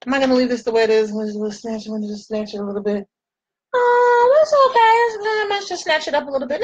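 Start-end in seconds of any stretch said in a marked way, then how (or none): 3.7-6.6 much higher and louder squeaky voice; playful squeal. Acted. Talking to herself in the mirror about snatching her eyebrows, high squeal. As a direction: squeal it like a delighted cartoon.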